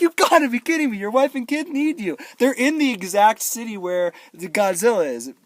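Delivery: loud, high-pitched incredulous scolding; mock outrage. Viewer scolding son for abandoning family, mock outrage.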